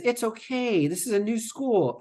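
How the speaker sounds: a hint of a soothing mother; mock soothing mom. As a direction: play the mom calming her child. Mom soothing crying child.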